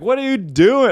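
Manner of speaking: high, loud alarmed parents; parents yelling. Retold or imitated. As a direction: yell at him to stop. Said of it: "my parents were like".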